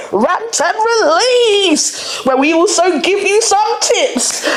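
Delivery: high, drawn-out jingle-like announcement; show slogan call-out. Pretend. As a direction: stretch out the show's name high and playful. Calls out the show's slogan.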